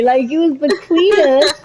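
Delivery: normal talking voice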